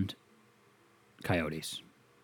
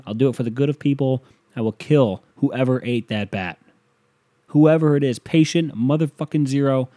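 loud, emphatic vow; mock-heroic defiance; 2 semitones higher, 6 dB louder than usual. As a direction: declare it like a hero nobody can stop. Declares mission in imagined argument.

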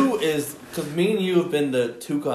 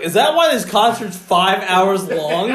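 laughing mock fan; 5 semitones higher, 7 dB louder, slower than usual. Fan complaining at concert.

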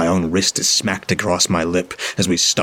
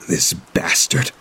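sudden fury; slower than usual. A narrator's reading. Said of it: "with a strike of sudden fury".

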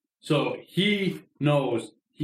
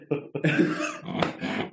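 breathy laugh. Real, not acted. Laughing at dad's savage text.

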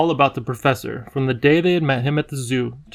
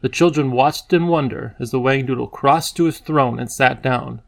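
usual speaking voice

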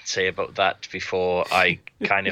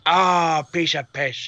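higher put-on Trump voice, cocky; Trump impression; 7 semitones higher, 4 dB louder, slower than usual. Trump boasting the word is easy, mock Trump voice. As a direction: brag it like Trump, sure it's easy. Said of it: "a guy doing an impression of Donald Trump".

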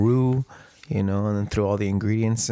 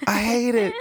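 mock whine; 13 semitones higher, 3 dB louder than usual. Mock complaint about the podcast audio.

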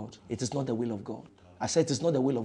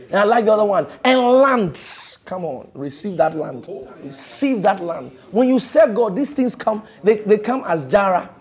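fiery preaching; 6 semitones higher, 8 dB louder than usual. Acted. Preaching at full force.

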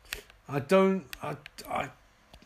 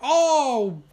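high, loud cry of dismay; a sudden loud "oh"; 8 semitones higher, 8 dB louder than usual. Real, not acted.

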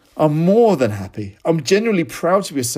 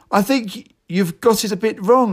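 higher, bleating middle-class voice; high-pitched bleat; 8 semitones higher than usual. Retold or imitated. Young man correcting an old postman, high bleat. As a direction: bleat it up high, a touch prissy. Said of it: "I bleated in my high-pitched voice".

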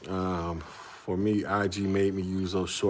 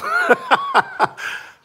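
laugh; 6 dB louder than usual. Real, not acted. Laughing at comment threads.

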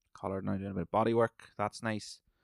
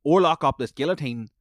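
loud, higher, urgent call; excited shout; 5 semitones higher, 11 dB louder than usual. Real, not acted. Fan urging the fighter during the fight, excited shout. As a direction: yell it as the chance appears. Live reaction to fight action.

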